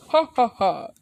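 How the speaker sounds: loud, high mock laugh; hero laugh. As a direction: belt out a hammed-up ha-ha-ha. All Might's acted laugh.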